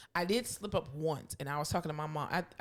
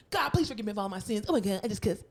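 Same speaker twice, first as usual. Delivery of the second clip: panicked prayer; 3 semitones higher, 4 dB louder than usual. Retold or imitated. Child's hurried prayer after cursing.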